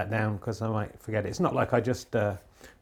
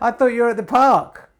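surprised; 10 semitones higher, 8 dB louder than usual. Real, not acted. Caught out by wife.

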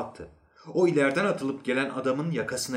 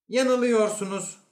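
high, loud objection; sharp contradiction; 11 semitones higher, 5 dB louder than usual. Acted. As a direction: contradict him with a raised voice. Inspector flatly contradicts psychologist.

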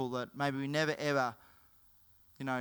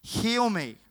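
raised, higher voice for a cry for help; retold shouting; 5 semitones higher, 5 dB louder than usual. Blind beggar calls to passing Jesus, mock shout. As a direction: call out urgently, as in a retold story. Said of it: "he began to shout".

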